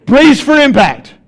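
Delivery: high and loud, slow, like a pilot's crash warning; hammed-up emergency announcement, drawn out; dramatic brace call. Bracing for bad news, dramatized.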